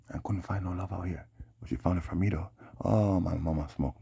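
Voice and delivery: ordinary talk, nothing marked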